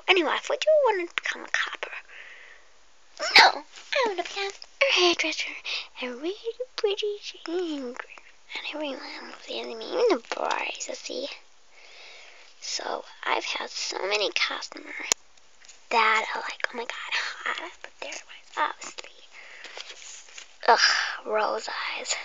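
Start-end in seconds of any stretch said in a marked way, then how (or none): 0.1-1.9 whisper. Acted. Whispering to the viewer before the pretend hairdresser game. 6.8-19.3 soft unvoiced whisper to the viewer. Whispering to the viewer before the pretend hairdresser game.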